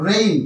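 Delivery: রেইন with the vowel gliding from e into i; correct pronunciation. Shown as a demonstration. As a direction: say রেইন as "re-in", with an "ei" glide. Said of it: "উচ্চারণটা হবে".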